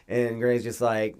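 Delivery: ordinary talk, nothing marked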